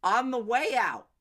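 usual speaking voice

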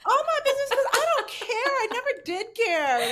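loud, high, through real laughter; shrieky, unrepentant laughing outburst; laughing admission. Real, not acted. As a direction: own up to it, cracking up. Teased about oversharing, both laughing.

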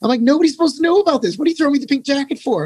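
high, indignant outburst; outraged. Retold or imitated. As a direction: protest the unfair punishment. Outraged at getting the jacket.

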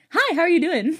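high, loud perky service greeting; bright cashier hello at the counter; customer service voice. Shown as a demonstration. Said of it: "Like, that's mine".

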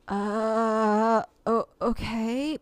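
drawn-out, unsure okay, imitated; slow, stalling reply, played for effect; retold wary hesitation. Adult recalling being befriended online, acting out wary hesitation. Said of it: "I was like".

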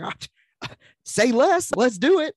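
high, loud eager yelp with a laugh; eager yes. Retold or imitated. Answering his wife's movie offer, high and eager. Eager reply to wife, quoted.

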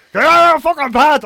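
high, loud imitated bellow. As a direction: roar it, running into garbled ranting. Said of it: "he got even more roary".

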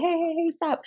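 high-pitched, repeated plea to stop; ineffectual pleading. Retold or imitated. Bystander trying to break up a fight, ineffectual pleading. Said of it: "takes a minute to pull people off".

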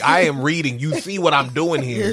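normal talking voice

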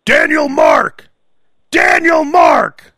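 loud, high, drawn-out full-name holler; slow shouted summons, playfully exaggerated; mock parent yelling. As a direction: stretch the name out like a scolding parent.

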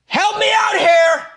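very high, drawn-out cry for help; comic exasperated shout. Acted. Host stuck pronouncing a town name, exasperated shout. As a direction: holler it in mock desperation.

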